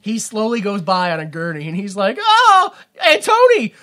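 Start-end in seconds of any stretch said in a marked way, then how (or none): 2.2-3.7 high, loud hammed-up calling out; mock call out. Hero on the gurney calling to henchmen, high loud mock call. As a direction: shout after them, high and drawn out.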